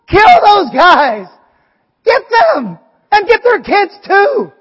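hammed-up furious psalmist calling for revenge; angry prayer. Preacher voices the raw psalm prayers, mock furious. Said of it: "They're like".

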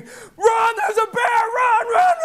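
high, loud scratchy yell; screaming. Retold or imitated. Sprinting uphill to prank the guys, screaming. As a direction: scream a frantic fake alarm, voice straining high. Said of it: "and I'm screaming".

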